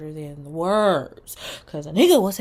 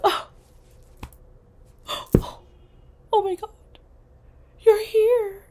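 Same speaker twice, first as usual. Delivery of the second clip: gasping, much higher and louder, hammed-up shock; fake surprise; 14 semitones higher, 5 dB louder than usual. Hammed-up gasping surprise, pretending not to expect the man. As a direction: overplay the gasp and the squeal. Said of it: "act like you don't know".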